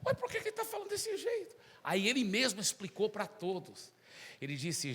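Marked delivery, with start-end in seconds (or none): 0.0-1.5 puzzled students. Acted. Students wonder aloud.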